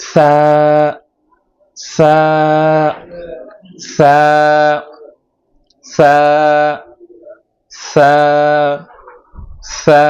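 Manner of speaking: সা with the tongue on the teeth tips, letting air out; demonstrating sa. Shown as a demonstration. Teacher drilling সা, airy tooth-tip demonstration. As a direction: breathe সা out between tongue and teeth. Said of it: "দুই দাঁতের আগার সাথে লাগিয়ে".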